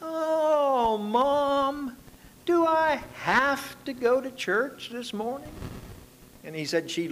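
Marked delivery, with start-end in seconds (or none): 0.0-5.5 drawn-out, high-pitched whine; slow, high, pleading whine; whining. Acted. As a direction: whine like a kid begging Mom. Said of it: "we would whine".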